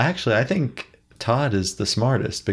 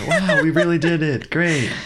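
mock celebration; 7 semitones higher than usual. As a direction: cheer it up with a laugh.

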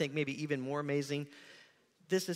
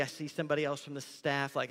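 usual speaking voice

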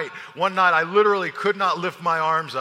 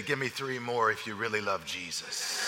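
leaning in close; 7 semitones lower, 9 dB quieter than usual. Retold or imitated. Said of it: "he'd lean in and say".

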